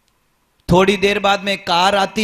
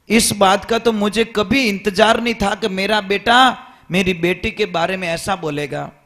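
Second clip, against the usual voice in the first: normal talking voice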